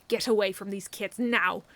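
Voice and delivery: loud, protective growl; growled threat. A narrator's reading. Snow warning the drunk men, loud growl. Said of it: "she growled".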